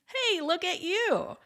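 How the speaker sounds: high, delighted hey; light up at seeing a friend; delighted greeting. Retold or imitated. Friendly guest greeting someone at a party, high and delighted. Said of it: "enter the party literally saying".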